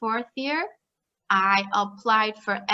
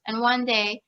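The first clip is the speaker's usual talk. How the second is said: ordinary talk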